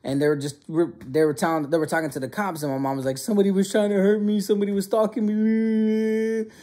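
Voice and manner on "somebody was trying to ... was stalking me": high, fast wail imitating a sobbing mom; mock hysterical crying. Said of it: "my mom just breaks down crying".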